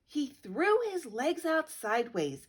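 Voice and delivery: ordinary delivery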